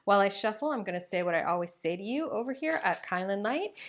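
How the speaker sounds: ordinary talk, nothing marked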